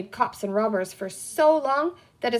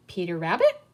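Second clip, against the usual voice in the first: curious guess; 3 semitones lower than usual. A narrator's reading. Green man guesses name.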